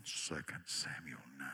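very low rumbling voice; deep voice. Shown as a demonstration. Preacher showing off a deep voice. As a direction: drop way down low and slow. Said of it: "I have several voices".

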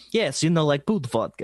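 buddy imitating a Russian character; mock Russian accent. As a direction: toss it out in a playful Russian accent. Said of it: "have people randomly, like, bring it up".

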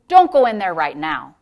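low and reasonable, fading to a whisper; nice coaxing voice. Retold or imitated. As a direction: imitate a deceptively kind adviser, hushing toward the end. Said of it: "was a real nice voice".

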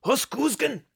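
high loud fake German shout; mock German accent. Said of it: "the German accent".

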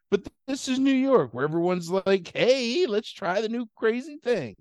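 high, mock-sassy New Yorker; mocking voiceover. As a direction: send up trendy New Yorkers.

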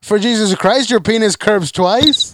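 a badly faked Southern accent; mock Southern accent. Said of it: "How do you go through three different accents?".

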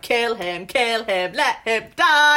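chanted urging building to a loud cry; bloodthirsty egging-on. Acted. Mock bloodthirsty cheering while reading.

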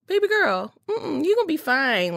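high, bright fond voice to a friend; imitating his mentor. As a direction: playfully voice his reassurance. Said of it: "he was just like".

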